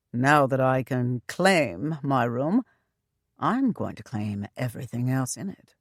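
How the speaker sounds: understated leering husband. A narrator's reading. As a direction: lean in with a playful leer. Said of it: "He leered at her".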